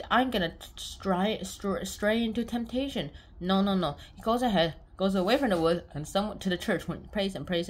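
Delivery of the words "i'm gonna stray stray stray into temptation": slow dramatic. Retold or imitated. Dramatic slow temptation line.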